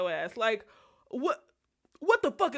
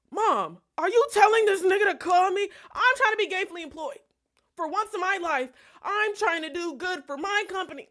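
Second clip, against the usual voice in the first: high, loud, exasperated complaint; 8 semitones higher, 5 dB louder than usual. Retold or imitated. Daughter complaining to mom about the calls, high and loud. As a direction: throw your hands up at mom.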